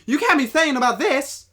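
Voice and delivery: very loud, high parody line reading; one-tone delivery. Retold or imitated. Co-host mocking the actress's lines, loud monotone. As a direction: hammer it all out on one high note. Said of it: "like, one tone".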